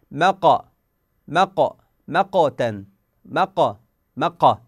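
مق said slowly with a vowel after the ق; vowel-coloured qalqala. Shown as a demonstration. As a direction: let the ق of مق take a short vowel. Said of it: "تحولت إلى فتحة بالحركة اللي سبقها". (قلقلة بحركة فتحة)

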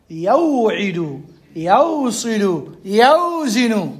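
very drawn-out word demonstration; original forms. Shown as a demonstration. Teacher showing forms before deletion, drawn-out demonstration. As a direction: stretch the "aw" in each word, then let it fall. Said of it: "شو الأصل قبل الحذف؟".

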